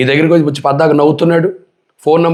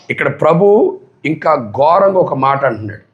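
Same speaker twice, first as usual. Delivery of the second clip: usual speaking voice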